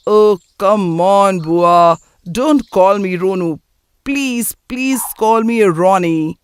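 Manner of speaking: slow, stretched-out English complaint; drawling protest. Acted. Nephew objecting to aunt's pet name, drawling protest. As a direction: drag the words out in protest.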